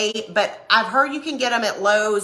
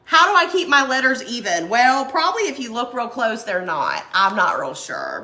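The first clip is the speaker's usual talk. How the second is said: normal talking voice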